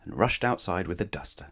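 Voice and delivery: plain reading voice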